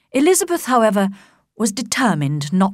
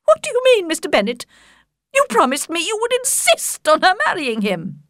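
much higher, agitated mother voice; a flustered mother begging for help, pitched up; fussy Mrs Bennet; 12 semitones higher than usual. Acted. Mrs Bennet protests to husband.

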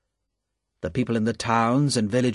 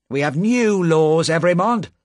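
sharply; 5 semitones higher, 5 dB louder than usual. A narrator's reading. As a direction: cut him off sharply. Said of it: "said the official sharply".

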